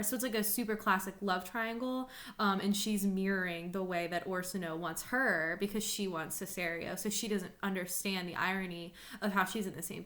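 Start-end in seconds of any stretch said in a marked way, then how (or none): none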